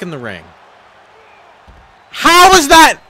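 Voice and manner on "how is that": very high, loud disbelief; outraged shout. Real, not acted. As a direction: yell in stunned outrage. Disbelief at game result.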